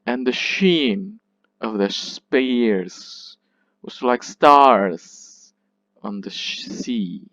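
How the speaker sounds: slow anapests, SHEEN, SPEARS, STARS and SEA drawn out; light "And the", then long full "sheen", "spears", "stars", "sea"; last words stressed clearly. Shown as a demonstration. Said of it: "last words must be pronounced very clearly".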